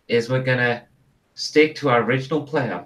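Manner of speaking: normal talking voice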